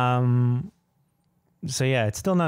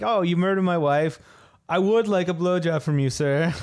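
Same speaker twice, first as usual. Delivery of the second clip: a bit higher, lightly courteous; mock polite proposition; 6 semitones higher than usual. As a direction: offer it like a polite gentleman. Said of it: "a proposition where you're like".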